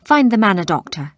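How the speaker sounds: a loud, firm royal command; ordering. Acted. King orders a doctor for the wounded warrior, loud and commanding. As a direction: bark the order at the servants. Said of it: "he ordered".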